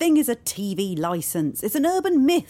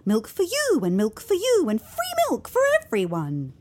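sing-song, swooping ridicule; mocking disbelief; 6 semitones higher than usual. Woman ridiculing belief in milkmen, mocking. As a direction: ridicule it like a silly fairy tale.

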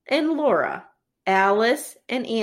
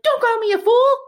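mock defensive outburst, much higher and louder; 12 semitones higher, 6 dB louder than usual. Husband answering his wife's insult, mock defensive shout. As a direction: imitate him firing back, high and loud. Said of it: "It's a real defensive response".

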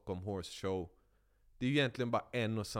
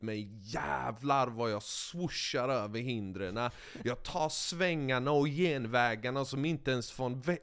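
normal talking voice

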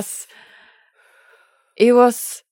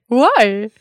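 curious whys; 9 semitones higher, 6 dB louder than usual. Retold or imitated. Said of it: "asking the question".

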